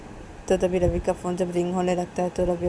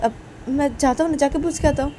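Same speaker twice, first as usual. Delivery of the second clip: eager brother; 8 semitones higher, 3 dB louder than usual. Acted. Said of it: "Rahul बोलते".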